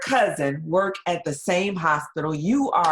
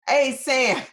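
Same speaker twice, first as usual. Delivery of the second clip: calling out; 8 semitones higher, 6 dB louder than usual. Retold or imitated. Charge nurse confronts her.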